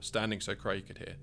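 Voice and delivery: plain reading voice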